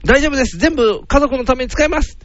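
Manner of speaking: mock-earnest high-pitched promise; earnest suitor. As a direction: play the boyfriend reassuring her father. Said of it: "お父さん".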